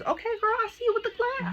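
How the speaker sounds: very high, playful squeal of approval; playful hype. Pretend. Vlogger cheering the brand for glass packaging, high and teasing. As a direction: hype up your girl in a high-pitched voice.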